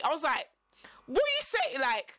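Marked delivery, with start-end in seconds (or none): 1.1-1.8 shocked question. Retold or imitated. Retold confused question to friend.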